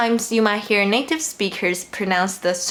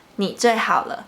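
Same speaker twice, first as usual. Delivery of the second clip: "你最好了" quicker, in one flow; natural speed. Shown as a demonstration. Teacher replays the phrase for learners, joined up and quicker.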